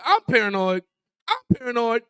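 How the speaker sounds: building from repeated words to a high, fast outburst; imitating a coach losing it; mock blow-up. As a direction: rise into a retold explosion at the player. Coach blowing up at Nick.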